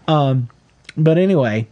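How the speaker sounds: ordinary talk, nothing marked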